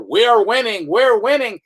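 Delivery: a hint of a cheery spokesman; lightly voiced insistent announcer; Baghdad Bob. Mimicking Baghdad Bob as tanks roll in, cheery. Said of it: "And Baghdad Bob".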